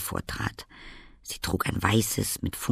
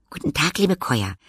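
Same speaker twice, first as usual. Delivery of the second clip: put-on light, higher girlish greeting; girl's voice; 7 semitones higher, 4 dB louder than usual. A narrator's reading. Girl greeting the little Käuer, friendly. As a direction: greet them kindly as a young girl.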